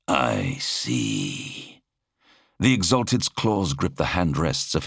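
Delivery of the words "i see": low, monstrous master's voice; deep, imperious horned creature; menacing monster lord. A narrator's reading. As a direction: question a servant in a deep creature voice. The Exalted takes bad news.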